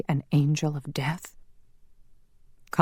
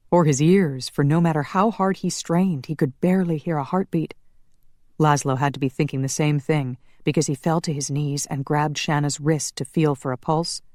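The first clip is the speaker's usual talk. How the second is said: plain reading voice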